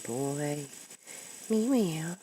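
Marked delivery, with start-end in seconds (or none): 1.5-2.2 high-pitched meow to the cat; imitated meow. Meowing at the cat.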